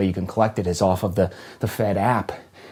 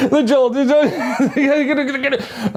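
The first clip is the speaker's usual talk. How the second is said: manic caffeine hype; 7 dB louder than usual. Acted. Acting out his morning coffee craving, manic and laughing.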